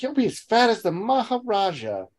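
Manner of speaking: high-pitched, scandalised busybody aunt; mock a fussing aunt, pitched well up; Aunt Harriet. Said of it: "Aunt Harriet's like".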